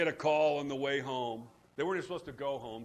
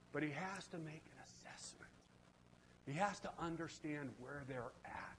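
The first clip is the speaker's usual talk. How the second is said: whisper; 11 dB quieter than usual. Real, not acted. Preacher hushing the congregation to a whisper. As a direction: drop to a hush.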